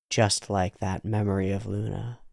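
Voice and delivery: ordinary talk, nothing marked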